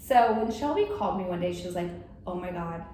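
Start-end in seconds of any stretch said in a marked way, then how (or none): none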